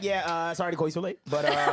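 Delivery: mock a hesitant late-night apology; Savon. Cohost apologizes for calling late, low teasing impression. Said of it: "He be like".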